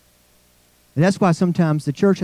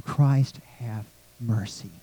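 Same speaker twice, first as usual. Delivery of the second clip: much softer, lower hushed plea; whispered prayer; 5 semitones lower, 8 dB quieter, slower than usual. Shown as a demonstration. Congregation quietly praying the Kyrie, hushed. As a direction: breathe the prayer under your voice. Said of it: "Kinda we whisper that prayer".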